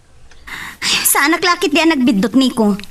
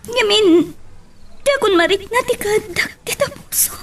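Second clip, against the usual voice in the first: tearful whisper; 7 semitones higher than usual. Acted. Crying confession of hurt.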